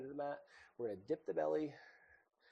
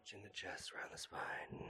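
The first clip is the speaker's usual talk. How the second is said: whispering; 3 semitones lower, 9 dB quieter than usual. Real, not acted. Whispered exhale cue.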